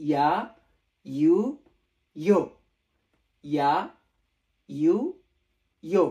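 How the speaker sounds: ইয়া, ইউ, ইয়ো as loud separate "ya, yu, yo"; pronunciation drill. Shown as a demonstration. Teacher repeating the new letters for learners, pronunciation drill. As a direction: model ইয়া, ইউ, ইয়ো one syllable at a time, pausing between. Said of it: "তাহলে কি হচ্ছে?".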